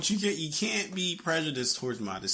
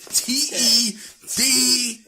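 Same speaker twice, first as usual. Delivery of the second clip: loud spelled-out letters; 8 semitones higher, 5 dB louder, much slower than usual. Real, not acted. To his friend, calling out the name letter by letter, loudly.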